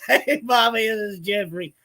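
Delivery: a lightly done chipmunk impression; mock squeaky app voice. Copying the app's high voice to the viewers, mock squeaky.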